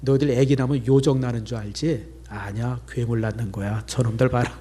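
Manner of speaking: put-on half-joking tease about monster kids; mild teasing. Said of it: "그 임신한 애 보고 그랬어요". (임신부에게 농담 섞어 살짝 놀리듯)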